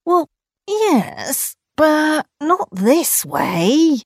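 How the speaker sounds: reluctant kangaroo. Acted. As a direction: drag it out unwillingly. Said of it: "replied Kangaroo".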